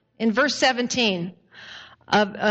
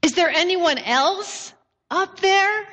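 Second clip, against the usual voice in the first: high, mock-pleading question; comic plea for a second opinion; 6 semitones higher than usual. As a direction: call upward hopefully, pitched high. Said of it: "he says pleadingly".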